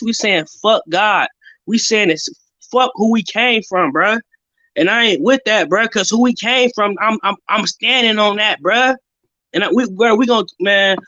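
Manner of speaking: usual speaking voice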